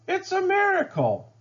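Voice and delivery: a crowd marvelling at a miracle; high, wide-eyed mild shout; amazed shout. A narrator's reading. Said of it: "They shouted".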